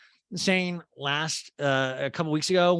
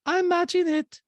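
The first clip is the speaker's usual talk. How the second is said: very high, loud mock voice; Diana impersonation; 5 dB louder, slower than usual. Host quotes the housewife matching a donation, high mock voice. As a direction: push it way up high, mimicking her. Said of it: "so Diana's like".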